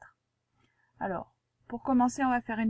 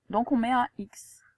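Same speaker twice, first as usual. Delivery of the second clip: whispered 'Donc on met un X'. Shown as a demonstration. Teacher confiding the rule to the pupil in a whisper. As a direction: whisper it like a secret.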